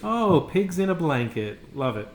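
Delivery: ordinary delivery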